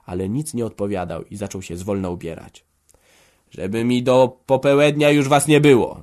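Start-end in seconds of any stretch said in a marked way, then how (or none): 3.5-6.0 loud, higher call from the hallway. A narrator's reading. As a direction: shout the last order after them. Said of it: "zawołał jeszcze z sieni". (ojciec woła z sieni, głośno i gniewnie)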